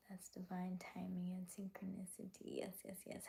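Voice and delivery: soft whispered aside. Real, not acted. Whispering a reply to a chat comment. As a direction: breathe the words out.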